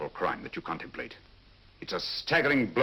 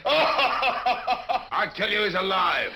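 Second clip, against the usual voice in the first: disguised sailor shouting, higher and louder; laughing shout; 11 semitones higher, 5 dB louder, much slower than usual. Acted. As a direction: bellow it in a rough sailor voice. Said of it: "An admirable disguise".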